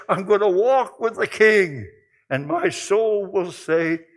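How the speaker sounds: high, loud pulpit fervor; rising fervent sermon cry; preaching at full force. Acted. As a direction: drive it home like a revival preacher.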